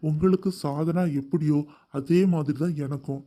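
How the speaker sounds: deep man's voice, mock-sincere and earnest; low, pleading sincerity; heartfelt. A narrator's reading. Groom earnestly persuades the mother, deep and heartfelt. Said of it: "உருக்கமாக கூறினான்".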